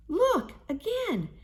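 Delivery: Mama Hyena exclaims over the sudden plenty of food; delighted exclaiming. A narrator's reading. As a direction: squeal with joy at the feast. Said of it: "exclaimed Mama".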